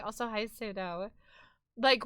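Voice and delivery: usual speaking voice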